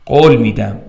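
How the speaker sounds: ordinary delivery, nothing marked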